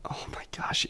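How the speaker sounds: hushed whisper. Real, not acted. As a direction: whisper it like a secret. Whispered aside, unvoiced.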